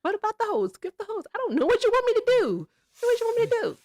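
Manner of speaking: high, fast mock retort; retorting mockingly. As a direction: fire it back high and fast. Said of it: "I was like".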